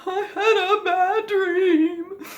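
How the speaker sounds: high, slow, tearful whine after a nightmare; scared child. Acted. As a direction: whimper it to parents at night, drawn out. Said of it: "you come into your parents' bedroom".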